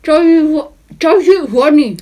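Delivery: talking round stuffed cheeks; mouth full. Real, not acted. Chubby Bunny game, marshmallows in mouth.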